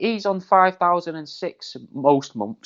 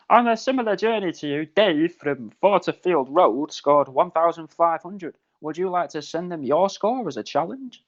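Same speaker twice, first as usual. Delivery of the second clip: higher-pitched, posh automated announcer; posh computer voice; 3 semitones higher than usual. Acted. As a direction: read it out like a posh in-car computer. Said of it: "in that posh computer voice".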